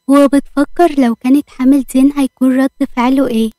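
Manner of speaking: usual speaking voice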